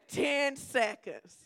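stretched out slowly through a laugh. Real, not acted. Pastor laughing at her own ten seconds. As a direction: laugh and drag the words out, amused at herself.

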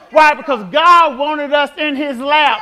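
loud, slow, tearful shout; preaching at full force, voice near tears; impassioned cry. Real, not acted. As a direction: cry it out with feeling. Preacher climax, crying out.

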